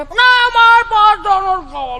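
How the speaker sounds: put-on whiny child. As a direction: whine it out like a spoiled kid. Child refusing. (જિદ્દી બાળકનો ઊંચો અવાજ)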